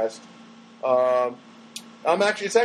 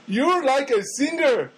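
imitated cashier; 7 semitones higher, slower than usual. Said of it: "she said to me".

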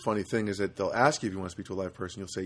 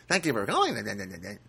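mock clerk's rote thanks; lightly mocking phone-agent patter; fake politeness. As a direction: parrot the scripted greeting into nonsense. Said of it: "I just hate that fake, fake politeness".

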